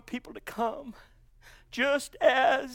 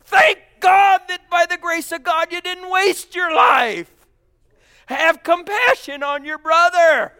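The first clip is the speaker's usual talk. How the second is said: full-force preaching; 8 dB louder than usual. Real, not acted. Preacher urging the congregation on, fervent shouting. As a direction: preach it out with rising fire.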